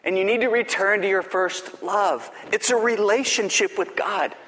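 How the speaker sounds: ordinary delivery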